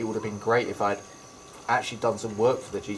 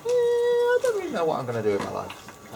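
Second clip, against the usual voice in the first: much higher, lost and unsure; unsure young self. Retold or imitated. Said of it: "sort of situations".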